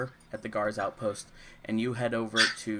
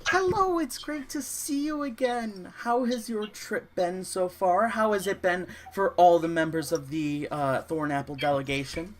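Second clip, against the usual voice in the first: very high, loud greeting voice; eager innkeeper woman; 9 semitones higher, 5 dB louder than usual. Acted. Innkeeper trying to stop a passing guest, high and gushing. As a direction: put on a high-pitched, gushing hostess easing down toward the end. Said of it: "and she goes".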